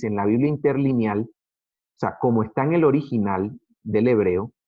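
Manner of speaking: ordinary talk, nothing marked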